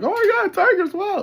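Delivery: hammed-up squeal of wonder; mock gasp. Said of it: "It's not like".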